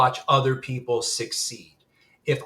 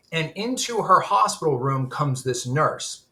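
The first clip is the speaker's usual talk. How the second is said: ordinary talk, nothing marked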